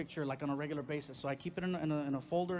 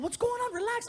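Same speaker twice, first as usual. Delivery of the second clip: put-on girlfriend alarmed; 4 dB louder than usual.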